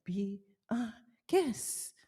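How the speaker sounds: soft, very slow showman's welcome; Lumiere. Acted. Baker cueing the candelabra's welcome for customers, theatrically. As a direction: draw it out quietly and theatrically. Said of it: "Cue the Luminaire".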